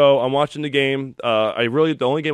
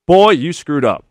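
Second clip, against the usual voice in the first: loud teasing jab at a son-in-law; loud, half-joking rebuke; mock scolding father-in-law; 3 semitones higher, 9 dB louder, slower than usual. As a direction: bark it out like a gruff in-law. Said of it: "my father-in-law goes".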